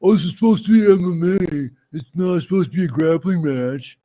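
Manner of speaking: high, loud whiny mock voice of a comment troll; mocking commenter. Mocking the comment section in a whiny voice. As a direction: sneer it out like a whining fan. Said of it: "everybody in that comment section was like".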